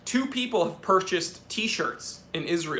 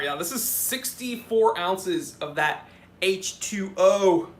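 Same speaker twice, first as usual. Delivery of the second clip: ordinary delivery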